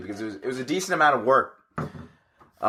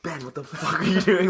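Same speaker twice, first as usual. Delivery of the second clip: higher-pitched mock indignation; retold indignant outburst; 4 semitones higher than usual. Friend to Ben walking in on him, mock indignant. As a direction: imitate an annoyed friend, half laughing. Roommate startled by intruder, retold.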